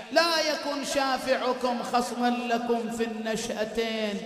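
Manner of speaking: loud, high impassioned sermon; full-force preaching. Acted. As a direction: preach it out to the mourners.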